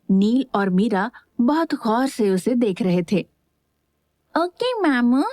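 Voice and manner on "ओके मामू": put-on high, sweet little boy voice; like a good child. A narrator's reading. Little nephew obediently answering his uncle in a high child voice. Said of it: "अच्छे बच्चे की तरह बोला".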